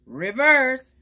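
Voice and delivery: loud truck command. Acted. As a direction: call out the order loudly. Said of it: "reverse means put it backwards".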